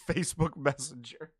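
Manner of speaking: normal talking voice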